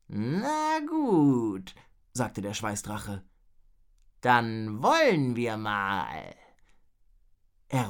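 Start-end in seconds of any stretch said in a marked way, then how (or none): 0.1-1.7 high, drawn-out dragon drawl; stretched-out creature voice; groaning dragon. A narrator's reading. Same ailing dragon agrees. 4.2-6.4 slow, swooping dragon voice; laboured, stretched creature voice; groaning dragon. A narrator's reading. Same ailing dragon gets to work.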